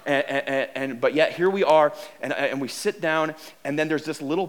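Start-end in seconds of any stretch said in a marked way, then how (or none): none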